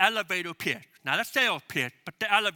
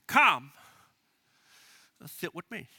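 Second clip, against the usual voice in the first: measured invitation; 3 semitones lower than usual. Shown as a demonstration. Pastor modeling the church invitation, measured and deliberate. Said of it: "here's the specific invitation".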